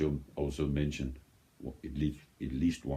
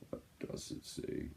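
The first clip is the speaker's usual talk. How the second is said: whisper; 12 dB quieter than usual. Real, not acted. Squinting to read the label.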